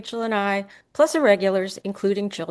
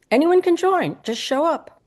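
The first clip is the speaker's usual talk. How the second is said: high-pitched, louder inviting call; open invitation; 9 semitones higher, 4 dB louder than usual. A narrator's reading. Narrator invites visitors in story.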